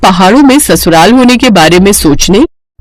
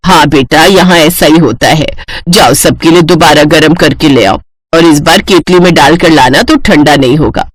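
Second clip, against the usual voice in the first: lower, matter-of-fact elder's voice; patient mother-in-law; 6 semitones lower than usual. Acted. Mother-in-law sends bride to reheat tea, patient.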